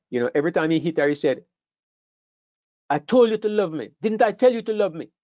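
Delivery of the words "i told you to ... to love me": raised, higher shouting voice; mock angry demand; angry husband. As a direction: bark it like a violent husband. Abusive husband beating his wife.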